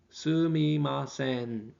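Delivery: Sumimasen drawn out slowly; slow again. Shown as a demonstration. Teacher repeating Sumimasen for students, slow. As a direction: stretch Sumimasen out for learners. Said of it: "slow repeat".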